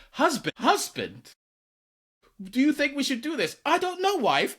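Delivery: high-pitched, hammed-up British accent; bad British accent. Two friends playing husband and wife, exaggerated British accent. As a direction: put on a hilariously bad British voice. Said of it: "hilariously bad British accents".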